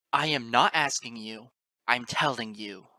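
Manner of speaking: loud, slow, furious insistence; sneering hiss. A narrator's reading. Narrator giving orders into the freezing gale, hissed and sneered. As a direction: sneer it forcefully, word by word. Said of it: "I hissed and sneered into the freezing gale".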